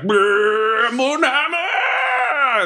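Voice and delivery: high drawn-out cry to the god; hammed-up invocation. Cleric channeling his god over the bird, stretched high cry. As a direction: call on Moonhammer in a long high wail. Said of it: "and I'm like".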